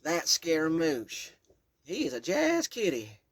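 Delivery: put-on slow, loud old Southern Kentucky woman; Southern Kentucky accent. Great-grandmother introducing the cat, loud slow Southern Kentucky accent. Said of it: "A deep Southern Kentucky accent emphasized her words".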